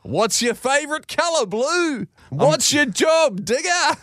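very high, mock-Australian questions lifting at the end; bad Aussie accent. Boss repeatedly teasing the Aussie landscapers, bad Aussie accent. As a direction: fire off one-liners in a terrible Aussie accent. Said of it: "all in a pretty shitty Aussie accent".

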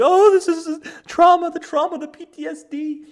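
very high, wailing mock hysteria; mock hysterical woman. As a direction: overplay the trauma, squealing. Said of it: "And it's like".

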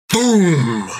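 plain reading voice

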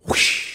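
soft breathy wind sound; whispered whoosh. Retold or imitated. Hair blowing sound.

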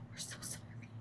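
hushed funeral-style condolence, whispered; imitating a whispered sympathetic murmur; mock whisper. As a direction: breathe it like at a wake. Audience consoling after flopped joke.

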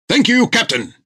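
high, stiff, at-attention voice; eager sailor voice. Acted. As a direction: report like a keen recruit. Tillum pleased.

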